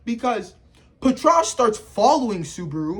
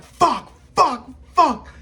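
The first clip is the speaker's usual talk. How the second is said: rising, louder anguished curses, near crying; anguished swearing; 6 semitones higher, 4 dB louder, slower than usual. Retold or imitated. Subaru banging his head on the door, anguished cursing. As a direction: curse in despair, voice cracking upward.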